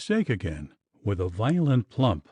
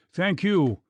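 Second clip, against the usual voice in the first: roared; 7 semitones higher, 3 dB louder than usual. A narrator's reading. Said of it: "roared Clough".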